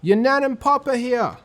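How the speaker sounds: high, loud call from the door. Acted. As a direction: call him from across the house. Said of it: "someone calling".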